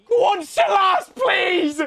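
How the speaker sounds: high, loud begging yell; desperate yell at the screen; pleading shout. Real, not acted. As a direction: scream the striker on to score. Willing the striker to score.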